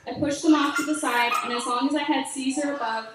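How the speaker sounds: ordinary delivery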